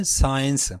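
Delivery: سائنس clipped to two flat syllables; the teachers' wrong سائنس; wrong pronunciation. Shown as a demonstration. Teacher showing the common mistake: سائنس. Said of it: "اس کو مس پروناؤنس کرتے ہیں".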